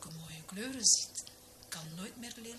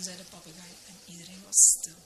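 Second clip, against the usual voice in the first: usual speaking voice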